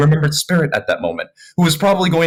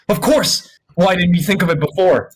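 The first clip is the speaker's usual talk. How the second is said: excited realization; 6 semitones higher than usual. Acted. Character realizes idea.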